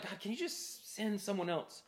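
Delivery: soft, sheepish request; hushed mock plea; reluctant prayer. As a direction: quietly ask God to let you off. Voicing a reluctant private prayer.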